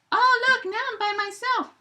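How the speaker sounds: very high, mock-forlorn bear voice; a hint of cartoon pout, very high; lonely little bear. Bear left alone in demo.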